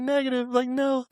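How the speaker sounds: high-pitched retold denial; acted denial. His acted denial to superiors.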